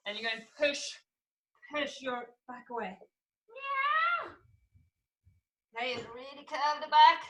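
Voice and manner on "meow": very high, soft meow; high-pitched cat cry, kept quiet; angry cat. Shown as a demonstration. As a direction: stretch out a cat's miaow. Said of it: "be a really angry cat".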